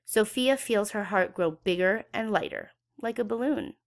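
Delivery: ordinary delivery, nothing marked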